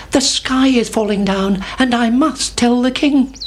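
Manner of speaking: high anxious chick. A narrator's reading. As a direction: cry out like a worried chick. Chicken Licken alarmed.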